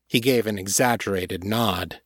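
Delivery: ordinary delivery, nothing marked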